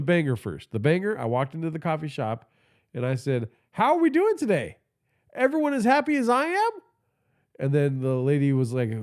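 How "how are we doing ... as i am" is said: cheery greeting. Retold or imitated. Retold cheery greeting in shop.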